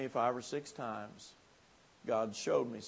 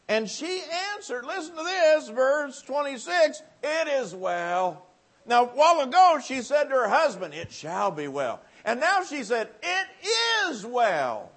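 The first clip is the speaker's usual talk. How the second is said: preaching at full force; 11 semitones higher, 9 dB louder than usual. Acted. Preacher proclaiming her answer loudly to the revival crowd. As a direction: preach it, drawing out "It is well".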